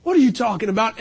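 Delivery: full-voiced puzzled question; voiced bafflement. Preacher repeats his question to God, full-voiced bafflement. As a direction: put the question out openly.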